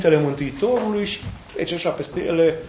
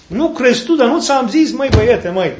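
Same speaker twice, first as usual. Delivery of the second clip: higher, louder chiding with a laugh; fond scolding; 7 semitones higher, 5 dB louder than usual. Acted. Jesus chiding Philip like a pupil, laughing. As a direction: scold the boy half-laughing.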